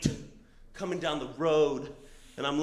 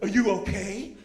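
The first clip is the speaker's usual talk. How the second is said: raised, concerned question; super concerned; 4 semitones higher, 4 dB louder than usual. Retold or imitated. Woman in Subaru checking on stranded rider, super concerned. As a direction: ask with retold alarm, pitch lifted. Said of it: "she's, like, super concerned".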